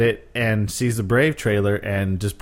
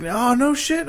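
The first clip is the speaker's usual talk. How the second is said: high-pitched mock astonishment; imitated wow reaction, pitched way up; retold amazement; 14 semitones higher than usual. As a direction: draw it out high, imitating an impressed guest. Retold amazed reaction to DreamWorks job.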